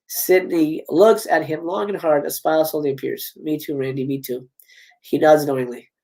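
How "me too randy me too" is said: low, soft sympathy; tender. Acted. Sydney accepts Randy's apology, gently and quietly. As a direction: lower and soften, understated warmth.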